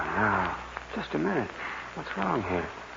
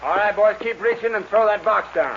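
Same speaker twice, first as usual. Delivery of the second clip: loud, higher barked order; holdup command; 13 semitones higher, 7 dB louder than usual. Acted. Bandit shouting orders at the stagecoach men. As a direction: stick them up and demand the box.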